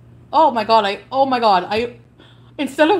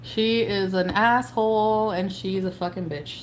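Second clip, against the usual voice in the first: normal talking voice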